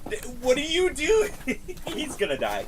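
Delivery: normal talking voice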